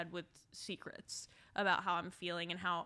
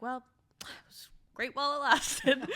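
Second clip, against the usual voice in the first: a louder, higher mock-cheerful shrug; mock breezy; 4 semitones higher, 8 dB louder than usual. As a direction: brush it off with fake cheer. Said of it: "I'm like".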